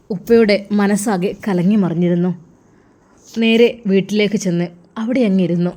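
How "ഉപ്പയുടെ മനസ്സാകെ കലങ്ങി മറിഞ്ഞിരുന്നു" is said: ordinary delivery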